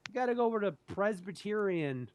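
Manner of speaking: high, outraged rep voice; hammed-up indignant insurer; mock insurance rep. Insurance company refusing to pay, outraged. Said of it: "my, uh, insurance was like".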